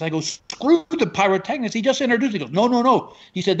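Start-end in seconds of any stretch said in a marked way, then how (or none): 0.2-2.3 high-pitched agitated objection; exasperated. Retold or imitated. Introduced comic to tech guy, high exasperated protest. 2.5-3.1 high-pitched quick objection; insistent refusal. Retold or imitated. Tech guy overruling comic, high insistent refusal.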